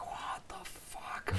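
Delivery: slow, whispered disbelief; awed whisper. Retold or imitated. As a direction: breathe it out in awe. Awed in the lobby.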